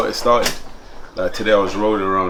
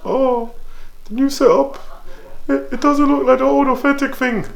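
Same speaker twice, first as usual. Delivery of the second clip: mocking the commenters in a much higher put-on voice. To the viewers, mocking fussy commenters' complaints in a high mock voice. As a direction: sneer it out like a fussy fan. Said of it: "'cause I seen the comments".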